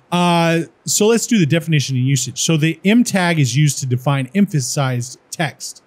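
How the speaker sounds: usual speaking voice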